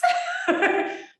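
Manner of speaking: a sudden high laugh at herself; high burst of laughter. Real, not acted. Amused by her own accent slip, high laughter.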